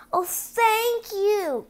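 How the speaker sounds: high, slow and breathless with gratitude; grateful cry. Acted. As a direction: Cinderella gushing her thanks, drawn out. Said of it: "cried Cinderella".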